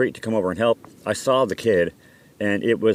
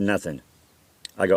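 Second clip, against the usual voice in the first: ordinary delivery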